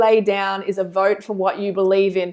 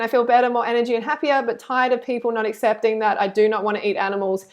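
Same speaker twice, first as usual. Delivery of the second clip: ordinary talk, nothing marked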